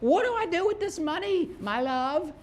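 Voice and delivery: high, mock-doting plea to a lover; lovestruck victim. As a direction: coo it like a smitten fool. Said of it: "you're gonna be like".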